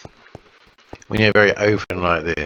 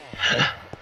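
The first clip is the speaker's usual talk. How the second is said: soft gasp; 6 semitones higher, 4 dB quieter than usual. Real, not acted. Chills at machine choice.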